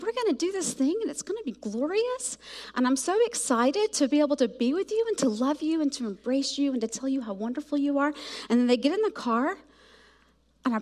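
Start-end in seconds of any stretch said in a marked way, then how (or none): none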